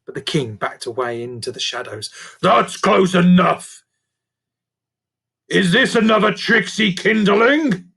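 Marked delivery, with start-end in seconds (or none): 2.4-7.9 fierce demand. A narrator's reading. Creature king warns the girl away, fierce. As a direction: warn her off fiercely. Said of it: "he demanded fiercely".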